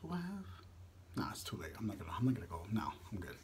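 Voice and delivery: low mumble to himself; muttering to himself. Real, not acted. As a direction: say it under your breath.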